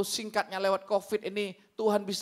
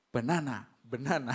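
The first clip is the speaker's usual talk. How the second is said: tag; 11 semitones lower than usual. Retold or imitated. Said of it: "Dalam hatinya".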